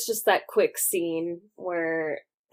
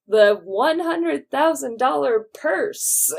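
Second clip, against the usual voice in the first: higher-pitched mock TV anchorman announcement; 7 semitones higher, 4 dB louder than usual. Recapping the film's TV anchorman to co-hosts, mock announcer. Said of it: "the anchorman being like".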